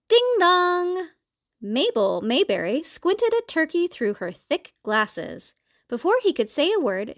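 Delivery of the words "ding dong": sing-song two-note doorbell; doorbell chime. A narrator's reading. Doorbell rings at the farmhouse, sing-song chime. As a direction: chime it high, holding the last note.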